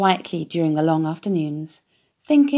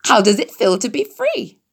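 higher, louder honking goose; 4 semitones higher, 3 dB louder than usual. A narrator's reading. Goose teasing Wilbur about freedom, honking. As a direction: tease the pig like a goose.

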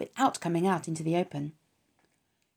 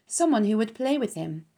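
plain reading voice

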